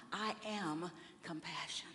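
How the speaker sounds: soft unvoiced whisper, slow and inward; whispered mantra. Shown as a demonstration. Preacher whispering the mantra to the congregation, hushed. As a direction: breathe the words like a private vow.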